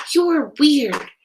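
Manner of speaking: high, loud, drawn-out jabs; punchy childish jeering; rude insults. A narrator's reading. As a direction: hurl insults like a mean schoolkid. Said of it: "the rude insults".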